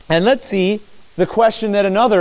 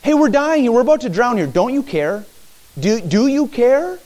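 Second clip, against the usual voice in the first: high, pleading panic; desperate disciples; 6 semitones higher than usual. Acted. Disciples in the sinking boat, high and desperate. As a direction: beg for help as the boat sinks. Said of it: "that's exact".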